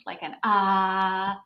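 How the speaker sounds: a long, loud, slow "ah"; demo ah sound. Shown as a demonstration. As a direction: draw the "ah" out like the breathwork group. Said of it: "start making a sound".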